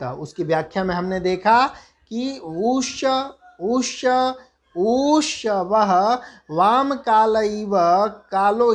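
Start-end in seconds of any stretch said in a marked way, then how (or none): 2.4-8.7 ऊष्य with the u lengthened each time, slow; drawn-out u sounds. Shown as a demonstration. Teacher repeating the vowel-length demonstration, slow. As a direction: stretch the u in ऊष्य longer on every repeat. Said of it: "एक मात्रा".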